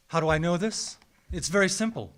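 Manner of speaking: ordinary delivery, nothing marked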